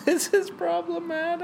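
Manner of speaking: very high squeaky mimicry; mocking falsetto. Teasing the co-host by copying their outburst, mocking falsetto. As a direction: echo it back in a tiny falsetto.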